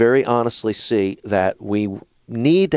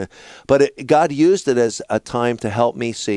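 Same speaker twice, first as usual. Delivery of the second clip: usual speaking voice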